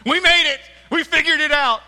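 very high, loud cheering; celebrating disciples. Retold or imitated. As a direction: whoop like you've won.

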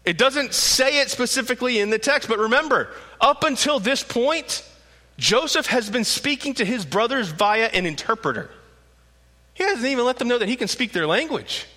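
usual speaking voice

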